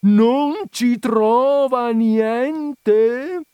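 high, loud, very slow outraged repeat; ranting. A narrator's reading. Visitor repeating her words at her, ranting. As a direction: stretch every word in furious disbelief. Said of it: "strepitò l'altro".

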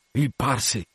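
ordinary delivery

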